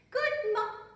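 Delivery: high loud greeting breaking off in shock; cut-off greeting. Acted. Princess sees her trunk, high greeting cut off. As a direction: stop dead mid-word. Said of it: "she gave a big scream".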